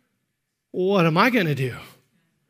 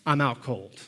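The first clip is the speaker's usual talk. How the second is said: ordinary talk, nothing marked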